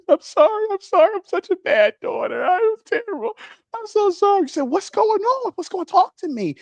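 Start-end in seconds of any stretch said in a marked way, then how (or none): 0.1-4.5 high-pitched whimpering teen apology, hammed up; mock crying. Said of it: "she starts crying". 4.6-6.6 high, pleading mock-mom voice; worried mom. Acted worried mom answers crying daughter.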